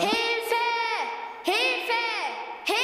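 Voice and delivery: fluttering cries for help. Acted. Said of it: "Mia rief um Hilfe".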